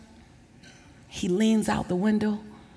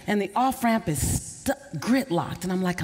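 ordinary talk, nothing marked

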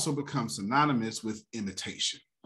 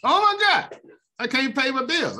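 higher and louder, a mock panicked cry; 7 dB louder than usual. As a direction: mimic someone panicking over the economy. Mocking panicked people.

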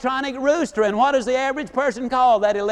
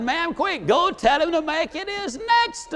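higher-pitched, hurried appeal; mock urgent plea; 6 semitones higher than usual. Nervous passenger to the stewardess about the landing, mock urgent. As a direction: plead urgently, playing up the alarm.

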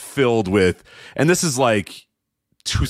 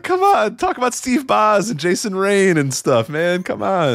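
pleading come on; 9 semitones higher than usual. Retold or imitated. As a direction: beg him to talk about the old days. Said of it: "I was like".

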